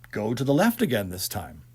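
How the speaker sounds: usual speaking voice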